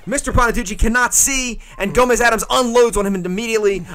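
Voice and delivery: hammed-up wrestling outrage; excited commentator. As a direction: sell the chair shot like a TV announcer. Wrestling play-by-play.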